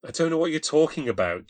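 high, loud panicky denial; scared girl on the sofa, panicking; panicked. A narrator's reading. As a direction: push him away in panic. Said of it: "she said with panic".

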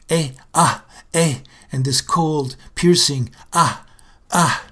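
building from tense groans to high, loud strained cries; straining birth cries. Acted. The baby being forced out into the world, rising strained cries.

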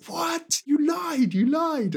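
high, outraged accusation; angry. Retold or imitated. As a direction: hurl the accusation in disbelief. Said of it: "And he's really angry".

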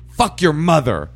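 high, loud, drawn-out insult; angry caller. Retold or imitated. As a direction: imitate a caller hurling an insult. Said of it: "And then she goes".

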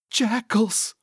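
high-pitched sidekick fright; alarmed. Acted. Cecil frightened by jackals.

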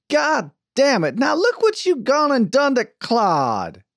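slow, loud, high exasperated outburst; high drawn-out reproach; angry blaming. A narrator's reading. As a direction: scold him, stretching the words out in outrage. She blames Archie for Claude's tears.

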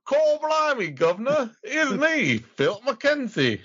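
very high, hammed-up cockney caricature; exaggerated high-pitched British character voice; mock British accent. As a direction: squeal out a silly British introduction. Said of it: "So how's your British accent".

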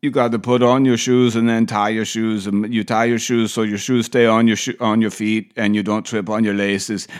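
mock Austrian-accented lecturing parent; over-explain in an imitated Austrian accent; Arnold accent. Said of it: "imagine this guy being your parent".

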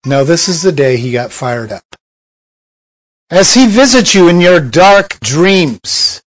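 ordinary talk, nothing marked

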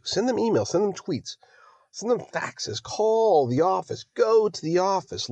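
high-pitched, worked-up urging to act; emotional plea. Real, not acted. Podcaster urging listeners to contact representatives, worked up and emotional. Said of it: "Sorry, I got emotional there at the end".

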